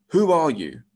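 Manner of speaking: loud, high challenge from a guard; demanding. A narrator's reading. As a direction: demand an answer sharply. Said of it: "he demanded".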